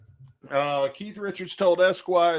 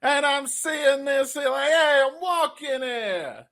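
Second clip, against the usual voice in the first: high, slow New York tough-guy voice; 11 semitones higher, 5 dB louder, slower than usual. Retold or imitated. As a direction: strut it like a street wiseguy. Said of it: "I'm just picturing [name]".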